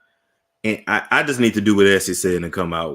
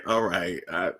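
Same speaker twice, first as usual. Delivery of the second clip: ordinary talk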